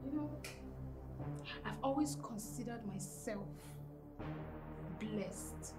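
very low, hushed voice; hurt, subdued. Acted. Daughter facing her mother's betrayal, hushed and hurt. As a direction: say it softly, wounded.

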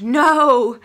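a short loud yelled no, replayed for the camera; retold scream. Reader reliving a shocking plot twist, loud shout. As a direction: shout it like the book just shocked you. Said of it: "like, screamed".

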